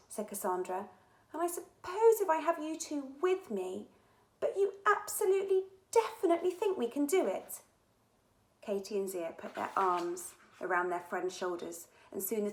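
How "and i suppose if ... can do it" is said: put-on girl voice. Hesitant Cassandra.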